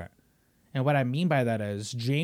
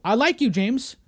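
loud mock boss; 7 semitones higher, 5 dB louder than usual. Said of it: "Imagine I'm David Zaslav".

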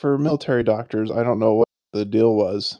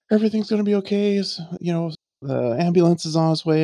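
high-pitched mock panicky reassurance; imitating frantic teacher; 7 semitones higher than usual. Teacher losing it while telling an injured kid it's okay, mock frantic. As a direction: rush out reassurance in a raised, imitated voice. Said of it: "she is just losing her marble".

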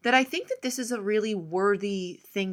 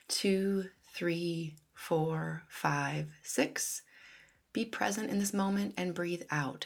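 careful unhurried voice; 3 semitones lower, 4 dB quieter than usual. Acted. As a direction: count the breaths calmly and softly. Leads breathing meditation.

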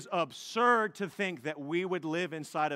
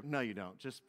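ordinary talk, nothing marked

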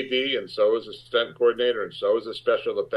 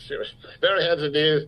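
ordinary talk

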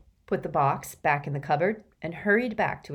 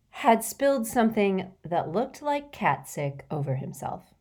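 plain reading voice

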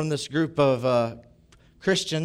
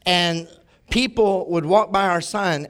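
ordinary talk, nothing marked

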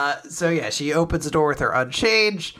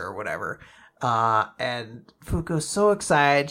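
ordinary talk

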